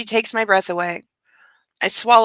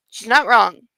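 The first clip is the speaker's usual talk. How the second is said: ordinary delivery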